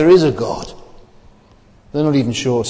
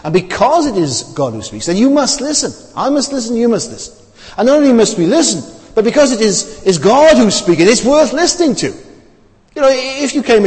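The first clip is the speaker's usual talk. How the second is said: rising, much higher emphatic preaching; preaching at full force; 9 semitones higher than usual. Real, not acted. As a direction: drive it home to the congregation. Preacher urging the congregation.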